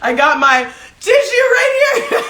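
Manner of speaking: high, helpless giggly voice; laughing through happy tears; tearful laughing. Real, not acted. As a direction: squeak it out between laughs. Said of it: "I was crying".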